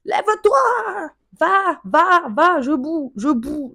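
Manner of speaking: high, loud exasperated shout at him; explode, boiling over; shouting at the boyfriend on the couch to get up and leave; yelling. Retold or imitated.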